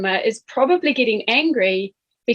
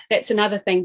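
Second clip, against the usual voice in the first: normal talking voice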